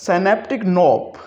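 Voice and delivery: knob said as nob, very slow; silent k. Shown as a demonstration. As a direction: synaptic knob drawn out slowly, k silent. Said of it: "के को pronounce नहीं करेंगे". (knob में के खामोश)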